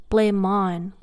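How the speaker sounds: "blame on" as one linked unit, M flowing into the vowel; slow down and link the M of "blame" into "on". Shown as a demonstration. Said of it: "where they connect the M with the vowel A".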